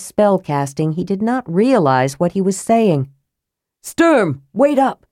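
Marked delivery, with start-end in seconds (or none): 3.8-5.1 high, urgent call. A narrator's reading. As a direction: call after someone running ahead. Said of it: "Karaman called out".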